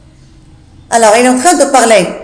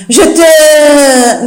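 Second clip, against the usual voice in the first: a sudden louder angry start; angry outburst; 5 semitones higher, 6 dB louder than usual. Acted. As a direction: bite off the threat. Jourdain loses his temper.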